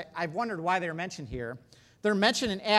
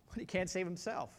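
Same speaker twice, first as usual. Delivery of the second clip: ordinary talk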